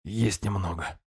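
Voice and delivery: dull hoarse croak; hoarse rasp. Acted. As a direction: rasp it out weakly. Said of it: "превратился в глухой хрип".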